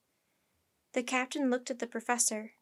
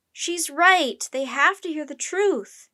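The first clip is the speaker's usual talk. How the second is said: loud, raised urgent cry; yelling; 4 semitones higher, 9 dB louder, slower than usual. A narrator's reading. Jack backs up his sister, yelling. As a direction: yell insistently to the crowd. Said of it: "yelled Jack".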